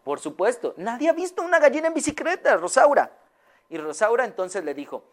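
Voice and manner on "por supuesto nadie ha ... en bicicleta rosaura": Señora Amelia. Acted. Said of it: "se le quedó viendo".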